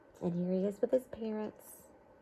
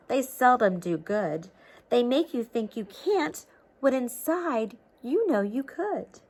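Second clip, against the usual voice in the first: lively storybook read-aloud; reading to children; 5 dB louder, slower than usual. A narrator's reading. Teacher reading a storybook to children, lively read-aloud. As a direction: read it like a picture book to kids.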